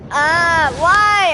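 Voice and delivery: high, loud exasperated wail; frustrated cry. Real, not acted. Gamer groaning at another miss, loud and high. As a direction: wail at the bad luck. Frustrated gacha pull fails.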